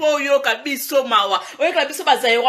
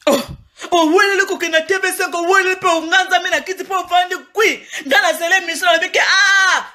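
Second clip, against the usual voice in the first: mock outraged shout; 7 semitones higher than usual. Vlogger wailing at the TV5 interview, outraged shout. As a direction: cry it out like a fan scandalized by TV5.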